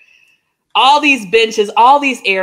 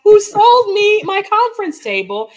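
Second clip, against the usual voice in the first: excited amazement; 10 semitones higher than usual. Real, not acted. Excited retelling of coincidence.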